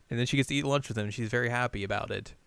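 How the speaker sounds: ordinary delivery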